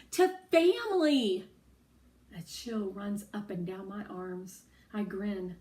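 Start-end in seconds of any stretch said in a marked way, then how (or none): 0.1-1.5 high, loud burst of joy; excited exclamation. Acted. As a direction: cheer it out. Excited guess about the destination.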